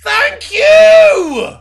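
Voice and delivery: loud, high-pitched yelled thanks; sudden mock shout. Co-host reacting to the captain answer, exuberant shout.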